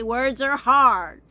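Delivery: HARD loud and drawn out; emphatic punchline. Real, not acted. Vlogger joking about her word trouble, loud drawn-out HARD. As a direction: land "words are hard" slowly, stretching HARD.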